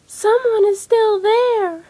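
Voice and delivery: high, loud, slow little bear voice; little baby voice. A narrator's reading. Baby Bear cries over his chair, high baby voice. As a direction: squeak it out like a tiny cub. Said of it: "in a little baby voice".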